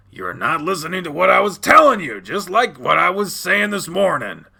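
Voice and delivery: angry scolding. Acted. Dad telling child off for not listening, angry scolding. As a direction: lecture the child crossly.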